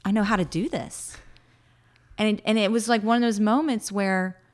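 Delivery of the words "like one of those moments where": ordinary talk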